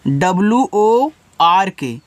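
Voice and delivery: slow letter-by-letter spelling; each letter drawn out. Shown as a demonstration. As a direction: spell it out slowly for the class. Teacher spells word by letters.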